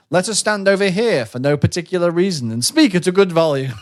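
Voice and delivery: hammed-up loud NPC; loud mock announcement. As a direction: boom it out theatrically. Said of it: "They were like".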